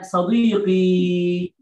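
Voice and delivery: صديقي drawn out slowly, stretching the final ي; slow, careful model word; slow correction. Shown as a demonstration. Teacher modelling for students, slow صديقي. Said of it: "لا تقول صديق أنا".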